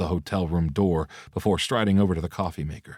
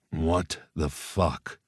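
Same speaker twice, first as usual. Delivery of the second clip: growled; 4 dB quieter, much slower than usual. A narrator's reading. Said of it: "Owen growled".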